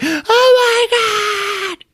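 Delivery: very high, slow drawn-out shout; hammed-up horror shriek; mock scream. Woman seeing the ghost appear, drawn-out scream. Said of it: "And she's like".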